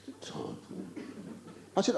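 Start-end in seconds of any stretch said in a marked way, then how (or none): 0.1-0.6 hushed, very soft whisper; whispered aside. Acted. Comic confiding the caller's name to audience, whispered.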